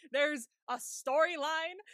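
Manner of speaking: ordinary talk, nothing marked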